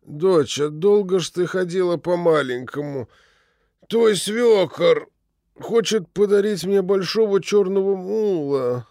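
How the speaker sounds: put-on slurred drunk old man mumble; drunken slurring. A narrator's reading. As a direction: mumble it tipsy, tongue tripping. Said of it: "заплетающимся языком пробормотал".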